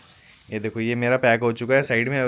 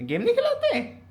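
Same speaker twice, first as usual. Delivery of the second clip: mock surprise. Mock shock to child.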